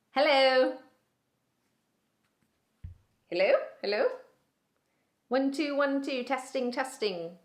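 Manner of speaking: high-pitched, uncertain sound check; Bridget testing a mic. Acted. Bridget starts her launch speech on a broken mic, awkward and searching. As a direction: call tentatively into a dead microphone. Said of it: "not even the mic is working".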